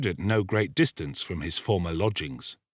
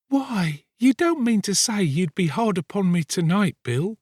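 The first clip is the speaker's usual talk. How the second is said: put-on high young woman's voice, gently reproachful; pleading girl; 11 semitones higher, 3 dB louder than usual. A narrator's reading. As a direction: plead with him in a much higher voice. Nancy pleading with Sikes.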